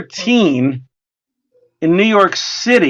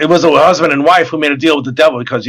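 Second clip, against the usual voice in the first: usual speaking voice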